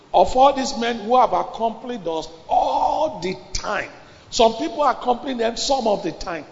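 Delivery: high, loud pulpit exhortation; fiery preaching. Acted. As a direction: drive the congregation with force. Preaching at full force.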